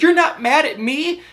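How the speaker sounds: high-pitched incredulous question; mock astonished checking; imitated surprise. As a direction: mimic her disbelief, pitched well up. Acts out her surprised question.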